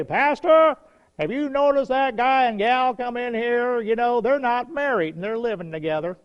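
high-pitched mock tattling; complaining board member. Board member reporting an unmarried couple, complaining. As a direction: confront the pastor with a busybody's complaint.

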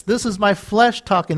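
usual speaking voice

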